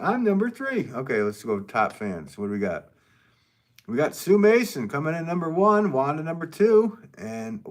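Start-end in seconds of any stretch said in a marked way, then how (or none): 0.0-0.9 high-pitched mock quote; quoting someone. Streamer quoting a viewer's claim to a rank, high mock voice. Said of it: "He said". 3.9-7.6 high, loud ranking announcement; mock announcer. Streamer announcing top fans in the ranking, announcer style.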